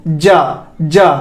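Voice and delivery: जा with a hard J, tongue touching; demonstrated J sound. Shown as a demonstration. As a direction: model जा twice as "ja", tongue on the tooth ridge. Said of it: "जा का उच्चारण कर पाएंगे". (जा को ज की ध्वनि से, जीभ लगाकर)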